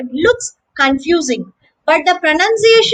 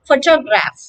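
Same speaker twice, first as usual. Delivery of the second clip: PHO-to-graph; "Photograph" with the weight up front on PHO; first-syllable stress. Shown as a demonstration. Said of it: "The stress is on the first syllable".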